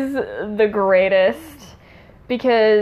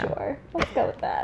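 whisper; 4 semitones higher, 8 dB quieter than usual. Real, not acted. Whispered aside, mic low.

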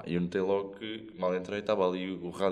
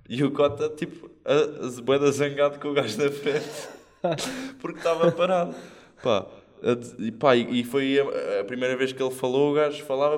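laughing retelling; 6 semitones higher, 8 dB louder than usual. Real, not acted. Laughing while telling Uber story.

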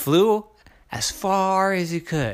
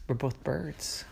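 whispered plea; 11 dB quieter than usual. Acted. Crow's last weak argument.

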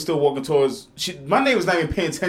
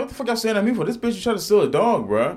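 higher, challenging demand; confrontational; 8 semitones higher than usual. Retold or imitated. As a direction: call them out sharply. Confronting staring dudes, retold.

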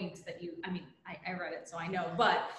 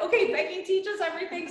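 excited; 11 semitones higher, 7 dB louder than usual. Retold or imitated. Eager quote from the team.

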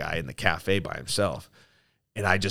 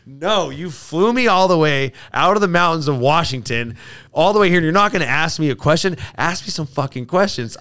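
loud, raised, defiant retort; cheeky indignation, pushed louder and higher; chirpy back-talk; 7 semitones higher, 7 dB louder than usual. Retold or imitated. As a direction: argue back, acting out the comeback. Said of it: "getting chirpy back with the guy".

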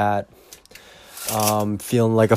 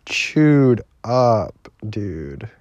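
ordinary talk, nothing marked

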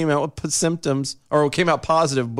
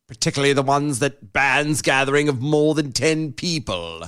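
usual speaking voice

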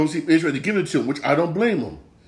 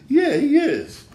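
laughing; 12 semitones higher, 3 dB louder, slower than usual. Real, not acted. Laughing concession about his importance.

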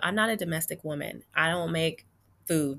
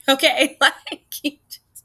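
high, loud, laughing through the words; laughing disbelief; 9 semitones higher, 9 dB louder, slower than usual. Real, not acted. Telling viewers he ghosted her, laughing disbelief. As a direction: let laughter break up the complaint.

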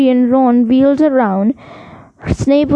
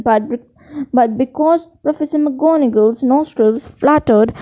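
ordinary delivery

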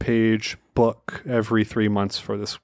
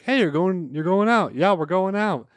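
a higher, lightly put-on gossipy neighbor; nosy neighbor voice; 8 semitones higher than usual. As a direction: chirp it like the prying neighbor, only lightly. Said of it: "and the hag's like".

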